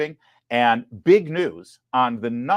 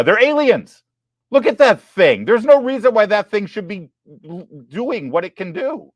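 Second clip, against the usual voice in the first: excited; 9 semitones higher, 9 dB louder than usual. Real, not acted. Mock-terrified rant about kangaroos.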